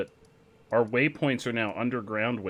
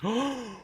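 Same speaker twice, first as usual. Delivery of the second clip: shocked gasp; 12 semitones higher than usual. Real, not acted. Giant crab appears.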